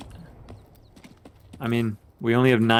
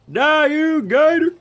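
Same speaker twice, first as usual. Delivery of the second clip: even higher, slower screech; shrieking battle cry; 5 dB louder, slower than usual. Real, not acted. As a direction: stretch the scream out, higher. Attacking an alligator in game.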